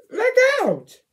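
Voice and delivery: very high, loud scream; loud cry. Acted. Child reader screaming the animal's cry. Character cries out.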